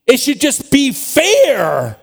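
high, loud, stretched-out complaint; indignant protest. Acted. Preacher voicing people's objection that scripture is unfair, indignant protest. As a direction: build the objection, dragging out the last words. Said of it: "we say".

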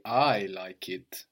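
"I" stretched long at the start; slow line with a long, weighted "I"; stress on I. Shown as a demonstration. Teacher showing emphatic I, stress on "I". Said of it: "and stress the I".

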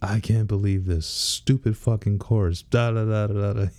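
put-on rant trailing into nonsense syllables; mock angry rant. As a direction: imitate a golfer's rant, hammed up. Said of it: "mad as hell".

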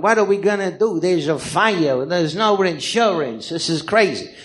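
hammed-up New York accent, stretched vowels; Cha Cha impression. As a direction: play the line in an exaggerated New York character voice. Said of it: "picked out this character I wanna do".

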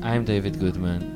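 ordinary talk, nothing marked

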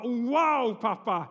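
put-on loud, thrilled child's cry to dad; be the boys, amazed by dad; kids' excited wow. The boys' reaction to the blink.

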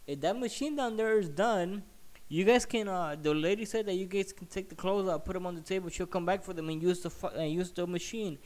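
acted-out lady calling over to customers; Colombian lady. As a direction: raise the pitch to mimic her. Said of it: "Colombian lady goes to them and say".

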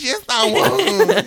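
hammed-up tearful wail, a touch slow; mock crying. [name] pleads with LaMarcus, hammed-up tearful wail. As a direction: wail it out like fake sobbing. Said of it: "Stop crying".

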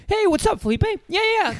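high, chirpy buddy-buddy support rep; overly friendly phone agent. Retold or imitated. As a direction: gush like a peppy customer service guy. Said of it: "really friendly".

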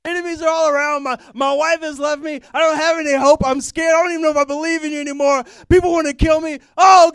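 hammed-up desperate wailing prayer to God; yelling prayer. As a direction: cry out to God at the top of your lungs. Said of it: "it seems like they're yelling when they're praying".

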